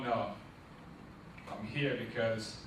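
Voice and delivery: usual speaking voice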